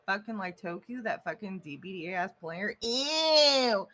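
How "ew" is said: disgusted ew. Acted. Disgust at a chat member.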